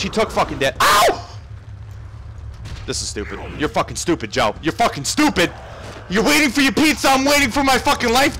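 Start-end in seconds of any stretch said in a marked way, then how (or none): 0.8-1.4 very high, loud yelp of "ow"; sudden screech on being hit; short high cry; startled yelp. Real, not acted. Hit in game. 6.1-8.4 high, loud angry yelling; pitched-up gamer rage; exasperated rant. Real, not acted. Ranting at game character.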